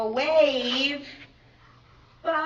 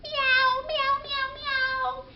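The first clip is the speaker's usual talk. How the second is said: much higher cat meows; puppet cat meowing; 13 semitones higher, slower than usual. Pretend. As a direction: meow like a cute puppet kitten. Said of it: "our friend the pussycat. Here he is".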